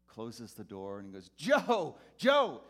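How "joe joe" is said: much higher, louder call of the name. Retold or imitated. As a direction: shout to a friend across the road. Drunk friend calling across highway.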